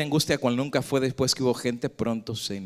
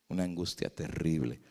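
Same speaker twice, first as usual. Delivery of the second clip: usual speaking voice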